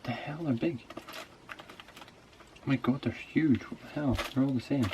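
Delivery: startled, breathy disbelief at the size; shocked surprise. Real, not acted. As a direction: gasp at how big they are. Shocked at the huge balloons.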